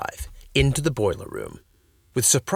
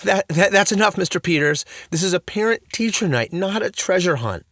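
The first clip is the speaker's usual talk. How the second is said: loud, stammering, shaky; 8 semitones higher, 4 dB louder than usual. A narrator's reading. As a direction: fight to keep control. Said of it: "her voice shaky".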